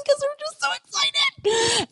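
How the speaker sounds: mock squeal of excited bridesmaids; squeaking girls. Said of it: "the girls are squeaking".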